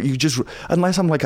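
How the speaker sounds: normal talking voice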